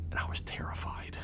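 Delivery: breathy whisper of fear. Retold or imitated. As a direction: whisper the confession. Confessing fear in the car.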